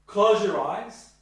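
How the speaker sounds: loud, slow "Close your eyes"; directive command. Shown as a demonstration. Therapist giving a blunt order to a client, loud. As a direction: order it firmly. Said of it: "Being directive".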